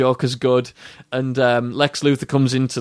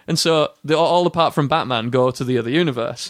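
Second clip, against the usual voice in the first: ordinary talk, nothing marked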